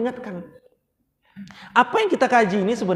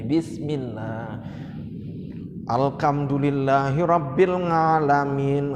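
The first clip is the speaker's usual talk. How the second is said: 1 bismillah intoned; 6 semitones lower, slower than usual. Real, not acted. Opening the recitation.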